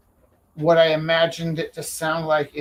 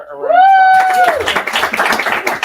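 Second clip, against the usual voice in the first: long, loud drawn-out call; announcer shout; 10 dB louder, much slower than usual. Acted. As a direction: stretch it like a ring announcer. Asking crowd to applaud Michael.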